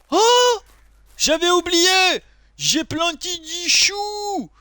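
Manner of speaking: high, drawn-out cry of discovery; delighted surprise. Real, not acted. Player suddenly remembering his planted cabbage, high surprised cry. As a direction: exclaim high and slow as it comes back to you.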